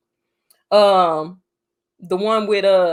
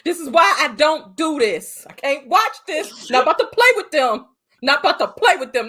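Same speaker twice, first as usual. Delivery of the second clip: high, loud, put-upon protest; higher, hammed-up complaining; mock exasperated rant; 6 semitones higher, 4 dB louder than usual. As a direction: act fed up and refuse. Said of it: "I said".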